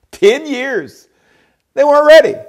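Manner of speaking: fiery high preaching shout. Acted. Preaching at full force.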